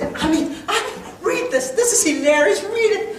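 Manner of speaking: high, laughing mock wife voice; imitating his laughing wife. Wife laughing as she hands him the letter. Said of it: "my wife came running in".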